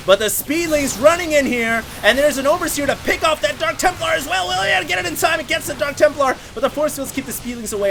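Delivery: hyped caster calling the action; excited. Real, not acted. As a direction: call the fight as it heats up. Excited casting of a fight.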